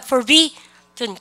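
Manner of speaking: deliberate drill of "forbidden"; pronunciation model. Shown as a demonstration. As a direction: say "forbidden" slowly for the class to copy. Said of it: "해보세요".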